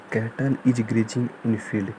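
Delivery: very slow, word by word sentence; slow dictation. Shown as a demonstration. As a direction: read it out for them to copy. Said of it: "dictating example sentence slowly". (धीमे से वाक्य लिखवाना)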